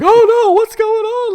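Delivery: very high, loud hammed-up wail; exaggerated shrieking alarm; mock panic. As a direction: imitate a hysterical lady in squealing falsetto. Retold lady panicking at explosion.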